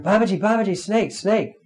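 high, alarmed cry of the name; retold frightened scream. Woman sees a snake and cries to Babaji, high mock scream. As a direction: call out in mock panic at a snake. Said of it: "she screamed out".

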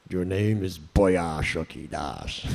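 put-on slow, low thick Bengali accent; Prabhupada's Bengali accent. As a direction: imitate an elderly Bengali guru accent. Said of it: "had this very thick Bengali accent".

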